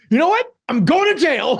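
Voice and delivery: high mock rant at idiots; Pacino ranting. Mocking Pacino yelling at the union men. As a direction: mimic Pacino berating them, climbing high. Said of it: "He's like".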